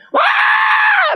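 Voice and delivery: preacher mimics his son wailing; mock toddler wail. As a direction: imitate a toddler howling as the taxi leaves. Child bursts into crying.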